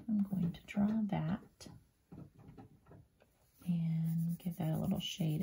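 hushed narration while drawing; soft, unvoiced whisper. Real, not acted. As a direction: whisper as you shade the picture.

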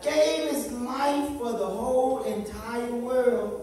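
slow, drawn-out preached lines; slow preaching. Acted. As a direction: let each phrase ring out. Preaching over music.

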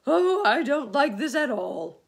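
loud, high worm wail. A narrator's reading. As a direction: wail in protest. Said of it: "wailed the earthworm".

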